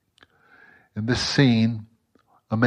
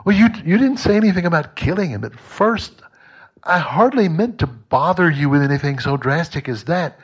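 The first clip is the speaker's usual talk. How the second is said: much higher, louder, flustered; backpedalling ghost; 7 semitones higher, 5 dB louder than usual. A narrator's reading. Ghost backpedals when the angel offers to kill, flustered and higher. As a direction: squirm out of the deal in a panic.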